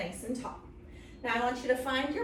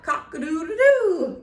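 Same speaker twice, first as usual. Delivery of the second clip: rooster crow; 5 semitones higher, 11 dB louder than usual. Shown as a demonstration. Rooster sound from the book.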